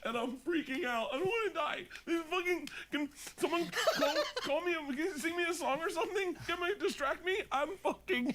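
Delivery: high-pitched mock tearful panic; hammed-up meltdown, pitch way up; crying, freaking out. Show host begging the crew for distraction, hammed-up crying panic. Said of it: "I was crying".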